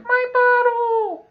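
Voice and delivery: put-on very high, loud, slow toddler wail; squealing baby cry-out. Old guy spotting his bottle, very high loud slow squeal. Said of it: "He's like".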